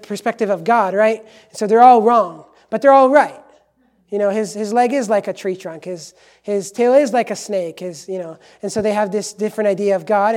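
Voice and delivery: loud, insistent sermon delivery; forceful preaching. Real, not acted. Preacher dismantling the elephant parable for congregation, forceful preaching. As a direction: press the argument on the congregation.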